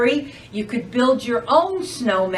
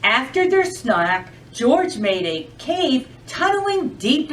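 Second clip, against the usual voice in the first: lively, sing-song storytime voice; animated picture-book narration; reading to children. Acted. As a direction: read it like a librarian to a room of kids.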